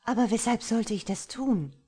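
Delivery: wondering. A narrator's reading. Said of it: "wunderte sie sich".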